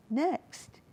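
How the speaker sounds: usual speaking voice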